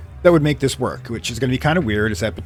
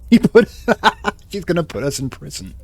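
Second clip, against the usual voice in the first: laughing; 8 semitones higher, 6 dB louder than usual. Real, not acted. Laughing at the game outcome.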